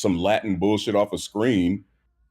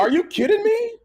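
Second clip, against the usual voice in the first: very high, louder disbelief; incredulous; 4 dB louder than usual. Real, not acted. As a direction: squeal it out in shock. Disbelief rant.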